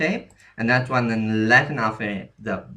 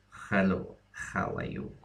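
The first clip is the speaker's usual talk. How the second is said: soft Russian accent with throaty, raspy H sounds; 2 semitones lower, 7 dB quieter than usual. Shown as a demonstration. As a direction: put on a Russian accent, scraping each H quietly. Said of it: "put on a Russian accent".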